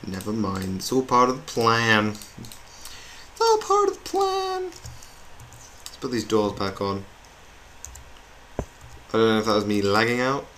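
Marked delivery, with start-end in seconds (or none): 2.1-6.0 very high, drawn-out silly voice; sing-song it way up in falsetto, slowly; tiny high-pitched echo of the line; squeaky falsetto. Pretend.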